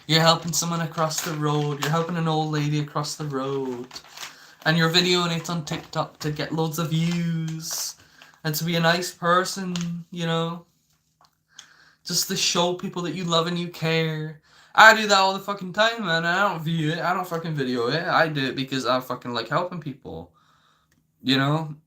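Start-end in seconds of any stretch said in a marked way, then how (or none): 0.1-14.4 higher, gushing mock-sweet voice; mock admiring viewers. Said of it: "people are watching these videos and thinking". 14.7-17.5 indignant outburst. Real, not acted. Indignant outburst after mock quote.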